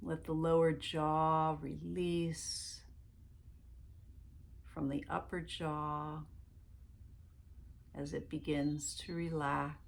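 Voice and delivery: calm meditation guide voice; guided meditation. Acted. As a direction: lead them gently into relaxation, one breath at a time.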